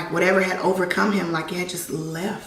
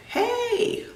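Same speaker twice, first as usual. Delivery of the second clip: much higher, puzzled questions to the mourners; a higher, pleading voice in the dream; confused calling out; 13 semitones higher than usual. Retold or imitated. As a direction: call out to them, bewildered. Dream self questions sad mourners.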